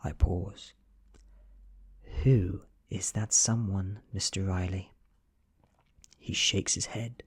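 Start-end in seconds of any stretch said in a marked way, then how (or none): none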